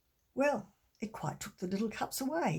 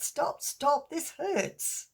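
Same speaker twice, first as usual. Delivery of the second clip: mock pained cry; 7 semitones higher, 4 dB louder, much slower than usual. Crying out to God in pain.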